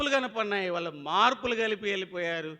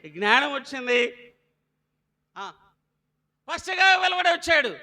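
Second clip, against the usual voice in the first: raised, ringing sermon voice; preaching; 8 semitones higher, 10 dB louder than usual. Acted. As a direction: call it out to the congregation. Preacher calling out to congregation.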